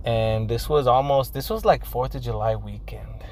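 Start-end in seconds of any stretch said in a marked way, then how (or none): 1.8-3.2 whispered aside. Real, not acted. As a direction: drop to a whisper.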